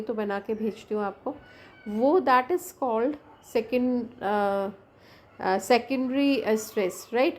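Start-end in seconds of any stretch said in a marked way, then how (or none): none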